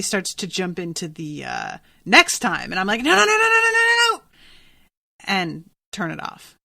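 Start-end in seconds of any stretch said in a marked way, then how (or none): 3.0-4.2 high, loud rapid-fire protest; hammed-up frantic string of refusals; mock panic. Viewer reacting as the next-time preview starts, mock panic.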